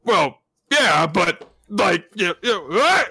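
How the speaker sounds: high, loud flustered yelp; flustered shout. Acted. Denji losing his grip, high flustered yelp. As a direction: stammer then cry out. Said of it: "he's like".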